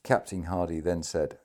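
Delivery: plain reading voice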